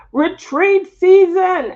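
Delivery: high, loud and slow, like a headline; excited announcement. Real, not acted. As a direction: draw out the words to announce them. Announcing the topic.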